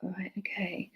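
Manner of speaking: very soft private murmur; hushed thinking aloud; whispering to oneself. Real, not acted. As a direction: say it under the breath. Murmuring to self while tuning in.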